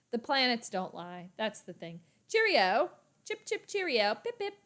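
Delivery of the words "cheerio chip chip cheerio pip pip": high, chirpy British accent; British talk. Shown as a demonstration. Bidding viewers cheerio, in high-pitched mock British. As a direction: toss out hammed-up British farewells. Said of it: "Yep, British talk".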